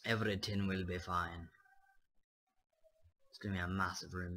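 normal talking voice

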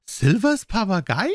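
high-pitched, incredulous question; startled squire. Acted. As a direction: squeak it out in astonishment. Squire startled by the captain's remark.

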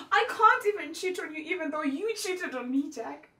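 high, loud exasperation; agitated, high-pitched outburst; outraged complaint. Acted. Acted complaint about his cheating.

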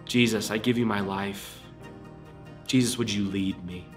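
ordinary delivery

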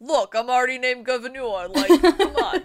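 laughing bad New York accent; a loud, laughing mock New Yorker. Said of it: "in his New York accent".

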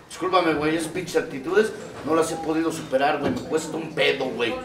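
sincere hushed admission; quiet apology. Real, not acted.

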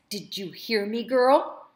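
put-on rising, loud on girl; menacing threat. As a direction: lean in threateningly.

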